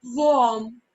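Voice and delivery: Warm said slowly as /wɔm/, no r sound; teacher shows the class how to say Warm, carefully; model pronunciation. Shown as a demonstration. To young pupils, teacher models Warm clearly. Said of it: "See how I'm pronouncing".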